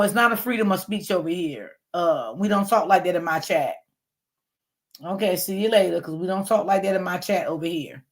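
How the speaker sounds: ordinary delivery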